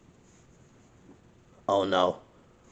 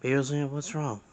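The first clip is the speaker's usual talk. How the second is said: ordinary talk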